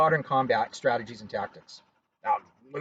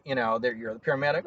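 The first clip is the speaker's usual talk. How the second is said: normal talking voice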